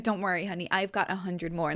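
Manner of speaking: a hint of a kind old grandmother; reassuring grandmother. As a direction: grandmother soothing the child who spilled tea. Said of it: "And she's like".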